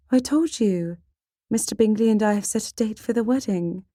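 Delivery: put-on high-pitched, insistent young lady; proper sister, mildly put out; elder sister Jane. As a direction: explain it to a pestering sister in a lifted voice.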